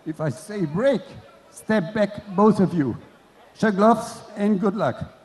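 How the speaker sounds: loud, firm referee commands; referee instructions. Acted. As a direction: bark the rules at both boxers.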